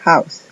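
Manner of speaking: house with H like Spanish J; English word house. Shown as a demonstration. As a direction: breathe a J sound into house. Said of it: "la H suena como J". ("house" con la H como J)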